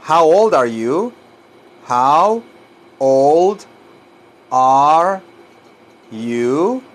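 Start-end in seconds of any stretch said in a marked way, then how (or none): 1.9-6.8 put-on HOW, OLD, ARE, YOU each drawn out alone; word by word. Shown as a demonstration. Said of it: "un poquito difícil de pronunciar".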